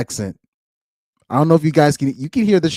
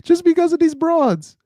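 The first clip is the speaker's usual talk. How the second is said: high exaggerated repeat. Real, not acted. Streamer repeats line for effect.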